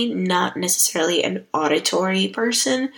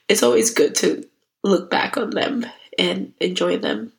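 ordinary talk

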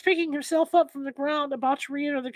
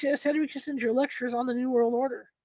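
ordinary delivery, nothing marked

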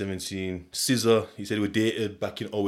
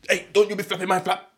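mock yell; 9 semitones higher, 6 dB louder than usual. Yelling at man who flapped flap.

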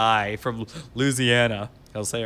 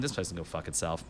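low muttered dismissal; 8 semitones lower, 12 dB quieter, faster than usual. Retold or imitated. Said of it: "I went".

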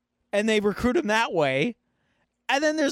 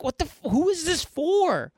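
stunned who is this for; incredulous; 9 semitones higher than usual. Real, not acted. Incredulous at the movie.

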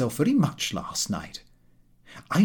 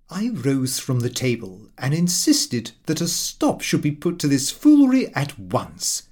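ordinary delivery

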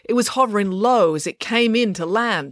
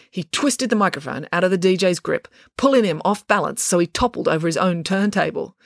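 usual speaking voice

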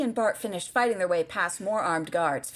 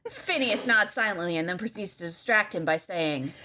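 normal talking voice

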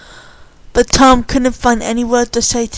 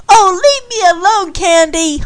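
annoyed holler, raised pitch; hollering back; 8 semitones higher than usual. A narrator's reading. Tom to Candy who came up behind him, hollering back. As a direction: holler back, wanting to be left alone. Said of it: "he hollered back".

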